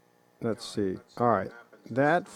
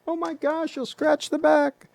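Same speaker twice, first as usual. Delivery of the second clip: high, squeaky mock fretting; mocking fussy voice. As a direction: imitate a fussy watch collector, pitched way up. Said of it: "People say".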